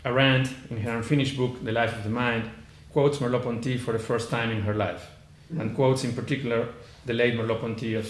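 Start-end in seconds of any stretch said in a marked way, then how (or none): none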